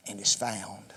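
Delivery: whisper. Acted. End of scripture reading.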